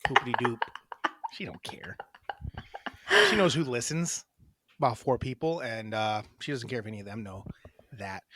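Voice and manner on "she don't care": whispered aside. Real, not acted. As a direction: whisper it behind her back.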